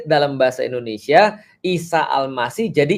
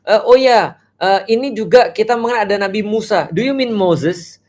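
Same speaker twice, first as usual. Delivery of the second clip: eager question; 6 semitones higher than usual. Pretend. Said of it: "kita omongin orang yang sama".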